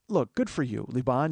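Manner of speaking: ordinary talk, nothing marked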